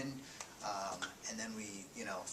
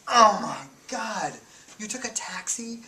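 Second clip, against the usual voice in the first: shocked disbelief; 13 semitones higher, 16 dB louder than usual. Retold or imitated. Vermont soldier shocked the journalists took a taxi, loud disbelief. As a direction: gasp it out in disbelief.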